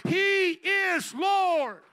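slow, booming, high cry; shouted proclamation. Real, not acted. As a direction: roar it out as the climax. Climactic proclamation.

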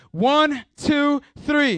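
high, loud count, each number punched; shouted count-in. Real, not acted. Preacher cueing the congregation to yell, shouted count. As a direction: count the crowd in at full voice.

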